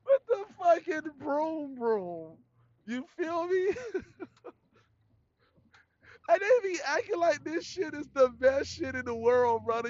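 high, laugh-choked words; talking through real laughter; barely getting the words out for laughing. Real, not acted. Cracking up at own joke.

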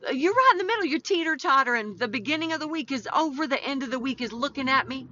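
high, animated play-acting; playful teetering. Host acting out Wednesday right in the middle, playful and high. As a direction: pitch it up, playfully balanced midweek.

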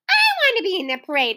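put-on high, fast eager children; clamoring. A narrator's reading. Children clamoring to join the parade. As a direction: pile in eagerly. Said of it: "cried the others".

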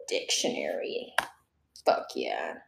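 a hushed whisper to herself; secretive little whisper. Real, not acted. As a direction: breathe the word under your breath. Looking it up, aside.

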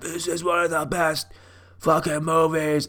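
drawn-out imitation of a gushing buddy; mock gushing fan. Imitating a buddy's Facebook post, mock gushing. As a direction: mimic an overawed fan, slowing at the end.

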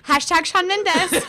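a dippy catchphrase; drawn-out sing-song; mock assistant. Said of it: "she always goes".

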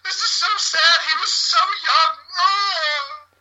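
a badly faked sob at the pony funeral; fake crying. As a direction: ham up grief over the stick pony. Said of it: "and I'm fake crying".

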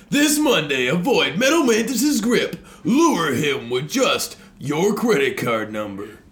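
high, loud hyped promo voice; monster truck ad. Pretend. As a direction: sell it like an arena ad, laughing. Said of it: "I got one more".

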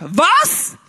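loud, high shouted what; deaf shout. Retold or imitated. To his wife at dinner, a loud high deaf shout. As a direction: shout it like you can't hear yourself. Deafened man asks wife.

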